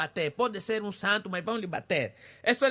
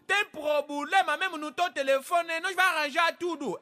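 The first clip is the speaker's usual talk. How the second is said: street hawker calling; 4 semitones higher than usual. Acted. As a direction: call out to passing customers like a hawker. Ficam aí a gritar na rua.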